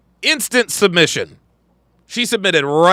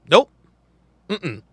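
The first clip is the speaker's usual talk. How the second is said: normal talking voice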